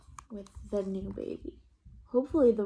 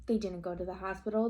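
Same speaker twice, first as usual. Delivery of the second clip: usual speaking voice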